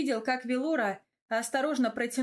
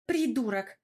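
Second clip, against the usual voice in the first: sharp, exasperated name-calling; spitting out insult; 3 semitones higher, 3 dB louder than usual. A narrator's reading. Велора insults the teasing Гаврил, spat out. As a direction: spit the word out at him. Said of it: "выплюнула ругательство моя девочка".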